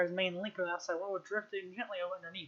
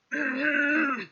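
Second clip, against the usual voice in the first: throat clearing; 8 semitones higher, 10 dB louder than usual. Real, not acted. Reader pauses to clear throat.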